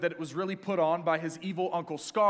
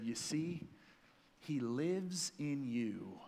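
gentle wise Rafiki; 3 semitones lower, 6 dB quieter, much slower than usual. Retold or imitated. Rafiki's revelation to Simba.

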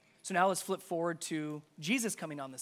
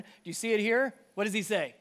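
ordinary talk

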